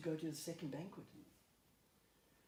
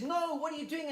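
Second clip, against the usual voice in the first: high, loud protest; urgent raised-voice rebuff; alarmed refusal; 13 semitones higher, 10 dB louder than usual. Acted. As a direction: push him away in alarm. Queen recoils from pleading man.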